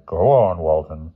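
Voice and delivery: sighing headmaster, mildly weary; resigned, sighing permission. A narrator's reading. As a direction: let him continue with a sigh. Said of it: "sat back at his chair and sighed".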